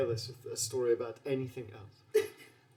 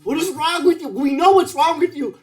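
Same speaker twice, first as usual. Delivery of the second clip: loud, incredulous yelling at a fool; exasperated shout; 21 dB louder than usual. Acted. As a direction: bellow in disbelief, louder each line. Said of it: "just staring at you like".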